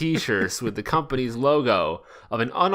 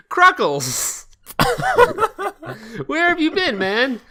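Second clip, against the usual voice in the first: high, loud giddy Sonic voice; stoned excitement; 8 semitones higher, 4 dB louder than usual. Acted. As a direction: burst out much higher and louder, overjoyed and stoned. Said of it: "he's really excited".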